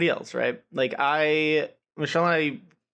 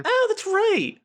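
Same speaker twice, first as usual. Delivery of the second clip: high, louder burst of remembering; sudden realization; 5 dB louder, slower than usual. Real, not acted. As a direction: exclaim as it suddenly comes back. Suddenly remembers about the hives.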